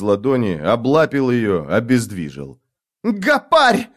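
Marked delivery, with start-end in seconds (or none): 3.0-3.9 put-on high-pitched girl's cry; screaming. A narrator's reading. Held-back girl crying out to the gang leader, screaming. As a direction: cry out the name high and desperate. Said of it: "зашлась в крике".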